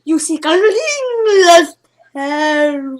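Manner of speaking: "ring of power" slow and loud, deeply dramatic. Shown as a demonstration. The druid telling the hero his quest, slow dramatic and loud. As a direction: intone it like an ancient oracle. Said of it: "in some really dramatic way".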